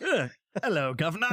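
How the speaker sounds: a lightly put-on British voice; mock British accent. Answering his co-host's joke in mock British accent. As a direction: join in the gag as a Brit. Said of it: "janky teeth and everything".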